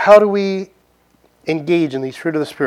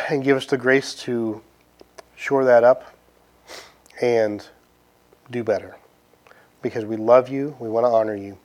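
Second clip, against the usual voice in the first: closing prayer; 3 semitones lower than usual. Acted. Pastor closing the sermon in prayer, gentle and earnest. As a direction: pray it gently to close the service.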